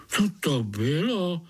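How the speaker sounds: startled little spiders. A narrator's reading. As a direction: ask it with a jolt of fright. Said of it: "vylekali se pavoučci". (vylekaně)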